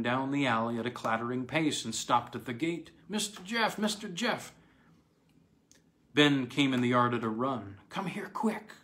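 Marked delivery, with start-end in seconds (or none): 3.1-3.8 put-on high, urgent call of an old man. Old man calls for Jeff. 3.8-4.5 high call sinking with a falling tune; old man calling again. Acted. 7.9-8.7 high, hurried whisper; urgent whisper. Acted. Frantic old man urges Jeff.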